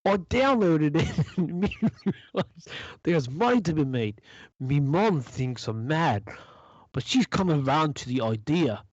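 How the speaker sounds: put-on light Kiwi accent on a quote; quoting her in a Kiwi lilt; new zealand accent. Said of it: "you sounded a little Kiwi there".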